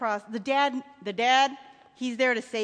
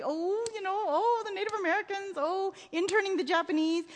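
high-pitched mock lament; hand-wringing, moaning imitation of guilt; mock self-criticism; 8 semitones higher than usual. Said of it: "we might criticize ourselves ruthlessly".

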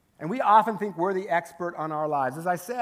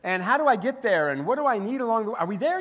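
excited, higher, laughing eager disciple; 4 semitones higher, 4 dB louder than usual. Retold or imitated. Disciples quizzing Jesus, excited and higher, laughing. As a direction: play a giddy follower peppering questions. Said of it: "you can kinda see the disciples going".

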